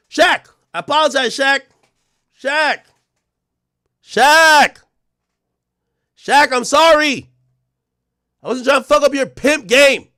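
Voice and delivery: high, loud, whiny begging voice; hammed-up crying plea to Shaq; mock pleading. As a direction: wail the apology like a desperate fan.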